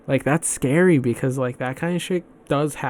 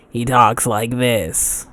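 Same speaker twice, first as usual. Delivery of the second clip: mock smoker's voice, slow and louder; smoker's rasp; 5 dB louder, slower than usual. Shown as a demonstration. Fan demonstrating an actor's one cartoon voice, smoker's rasp. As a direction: imitate a voice actor's heavy-smoker voice. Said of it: "He talks like he smoked".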